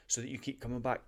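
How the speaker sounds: ordinary talk, nothing marked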